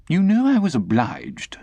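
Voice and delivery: high, fussy lady's voice; affected lady. Acted. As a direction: complain like a fussy old aunt. Camilla, high.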